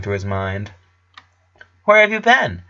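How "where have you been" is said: put-on high, eager boy's voice; excited. A narrator's reading. Clyde greeting his missing best friend, excited and high. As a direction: burst out with glad surprise. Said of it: "excitedly approaching him".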